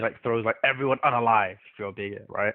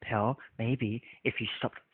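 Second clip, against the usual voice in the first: slow, quiet, strained imitation of someone losing patience; agitated, insisting calm; 7 dB quieter, much slower than usual. Retelling Puck's reply to being muted, slowly agitated. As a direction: imitate barely contained irritation, word by word. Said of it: "then he starts getting agitated".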